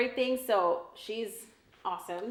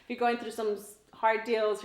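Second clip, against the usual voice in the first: usual speaking voice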